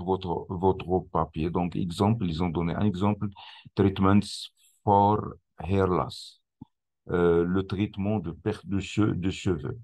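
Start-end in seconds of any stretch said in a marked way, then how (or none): 3.8-6.4 slow English with a French accent; careful, French-sounding English. Real, not acted. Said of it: "mon accent anglais".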